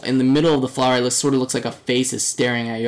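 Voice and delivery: ordinary talk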